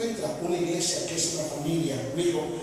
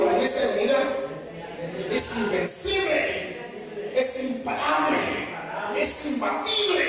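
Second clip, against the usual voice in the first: call and repeat; 4 semitones higher than usual. Shown as a demonstration. Preacher leading the congregation to repeat after him. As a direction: chant it for them to echo. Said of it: "Diga conmigo".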